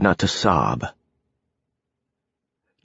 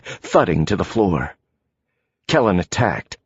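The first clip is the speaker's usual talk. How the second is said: ordinary delivery, nothing marked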